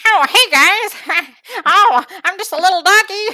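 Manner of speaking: high, squeaky little cartoon dog; Bob Saget dog voice. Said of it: "even your impersonation is, like, so funny".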